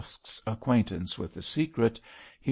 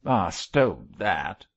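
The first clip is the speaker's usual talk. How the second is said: slow, disgusted growl; rough, dismissive brush-off; 4 dB louder, slower than usual. A narrator's reading. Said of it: "growled Mosk in a tone of disgust".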